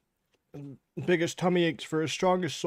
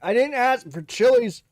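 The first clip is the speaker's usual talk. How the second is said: higher, slow whining about chilies; whiny indignant complaint; 8 semitones higher, 4 dB louder than usual. Real, not acted. Spice eater whining at the camera about chilies. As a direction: drag out the indignant whine.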